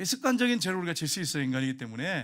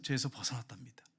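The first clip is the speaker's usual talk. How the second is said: whisper; 5 semitones lower, 7 dB quieter than usual. Real, not acted. Hushed testimony climax.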